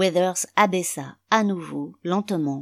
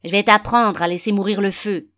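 loud, raised-pitch menace; angry threat; 4 semitones higher, 8 dB louder than usual. A narrator's reading. Father grabbing the cane to punish his son, loud and angry. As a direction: shout it as an enraged father.

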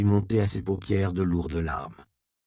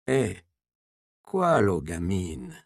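slow, lightly voiced old woman; old woman playing dumb; much slower than usual. A narrator's reading. Old mother to the provost, a slow hint of feigned innocence. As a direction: drag it out as if she doesn't understand.

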